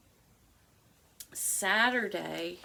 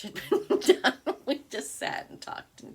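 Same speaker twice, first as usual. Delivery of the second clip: laughing; 7 semitones higher, 7 dB louder than usual. Real, not acted. Laughing at stitch night flop.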